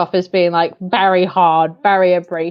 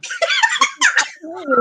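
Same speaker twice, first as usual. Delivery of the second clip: high burst of laughter. Real, not acted. Laughing at the critique.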